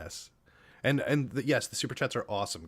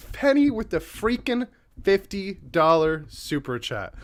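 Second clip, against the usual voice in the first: slow, stunned repeat stressing freaking; stunned emphasis; 7 semitones higher, 4 dB louder than usual. Real, not acted. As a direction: drag it out in disbelief.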